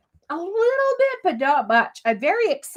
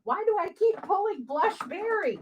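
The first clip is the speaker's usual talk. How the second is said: normal talking voice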